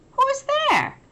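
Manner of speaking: mouse crying out. Acted. Startled mouse calls into the dark den, squeaky and high. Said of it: "Mouse cries".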